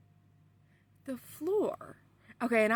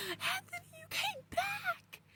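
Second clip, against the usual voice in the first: very high, drawn-out squeal, held back in volume; retold overjoyed cry; 5 dB quieter, slower than usual. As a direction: squeal it slowly, high and thin. Retold reunion with Anthony.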